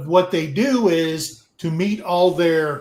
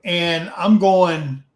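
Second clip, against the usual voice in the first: ordinary delivery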